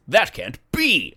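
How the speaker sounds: slow, drawn-out dramatic exclamation; hammed-up cartoon disbelief, rising high; anime dub outcry. Yu-Gi-Oh character when something bad happens, dramatic mock shock. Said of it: "like, in Yu-Gi-Oh".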